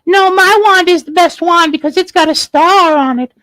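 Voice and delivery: very high fairy squeak. Acted. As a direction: pipe it up high as a boastful fairy. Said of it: "And the fairy said".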